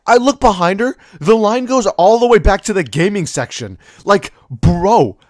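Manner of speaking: loud, high comic alarm; mortified outburst. Retold or imitated. Kid realizing he cut a whole line, mortified and loud. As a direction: tell it loudly, aghast at the blunder.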